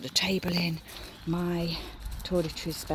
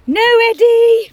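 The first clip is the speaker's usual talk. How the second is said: very high, loud, drawn-out no; loud drawn-out shout at Eddie the dog; 18 dB louder, slower than usual. Real, not acted. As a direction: yell the dog's name to stop him. Dog jumps on bed.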